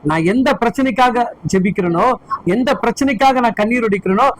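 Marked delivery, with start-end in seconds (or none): none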